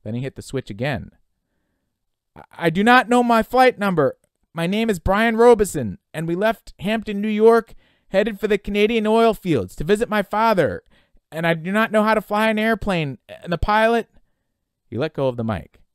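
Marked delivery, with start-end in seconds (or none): 2.3-14.1 loud, high, on the edge of screaming; strained, emphatic frightened yelling; voice starting to rattle. Acted. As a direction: shout each fact into the radio, barely holding off panic. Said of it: "His voice was starting to rattle".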